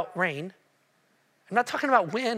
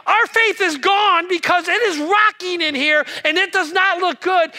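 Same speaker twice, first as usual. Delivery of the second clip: high, loud frantic protest; panicked disciples; 7 semitones higher, 6 dB louder than usual. Acted. Disciples answering Jesus as waves crash in, loud panicked protest. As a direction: shout it over a rocking boat. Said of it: "and they're like".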